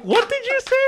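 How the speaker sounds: very high, loud mock indignation; incredulous, high-pitched comic outburst; retold shocked disbelief. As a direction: squawk it like an insulted dad. Retelling shock at son's insult.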